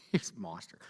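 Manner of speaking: breathy laughing whisper of awe. Real, not acted. To the audience about Tyrone, a laughing whisper.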